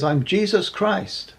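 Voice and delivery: high, slow call from above; Jesus Christ. Acted. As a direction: announce yourself slowly down a pit. Answer called down into the pit.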